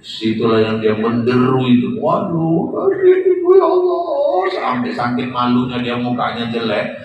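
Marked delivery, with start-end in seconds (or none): 2.0-4.0 very high hammed-up lament; keen it out like a widow at the grave; mock wail. Widow wailing at her husband's funeral, hammed up.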